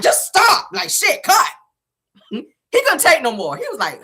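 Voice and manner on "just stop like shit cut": high, exasperated cut call; fed-up director. Retold or imitated. Marcel stopping the scene, fed up and exasperated. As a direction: call cut like you've had enough.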